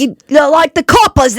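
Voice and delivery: loud, high mock Irish shout; Irish accent. Mocking hard-to-place accents for a friend, in an Irish shout. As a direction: bellow it like a stage Irishman. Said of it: "When they're like".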